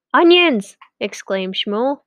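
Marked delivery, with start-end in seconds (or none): 0.1-0.8 high, loud outburst. A narrator's reading. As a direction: burst out with it. Shmuel exclaims.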